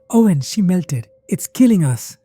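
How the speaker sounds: loud, high alarmed plea; shrill panic. A narrator's reading. Eliza grabs Owen's arm after watching her melt, shrill. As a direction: plead in shrill, rising alarm. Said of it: "her voice shrill".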